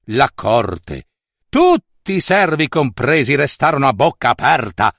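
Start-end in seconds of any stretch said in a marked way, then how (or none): none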